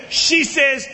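ordinary delivery

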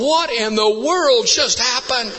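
slow, high-pitched astonishment; retold flabbergasted exclamation; bewildered disbelief. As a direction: stretch it out in stunned disbelief. Acts his own bewilderment.

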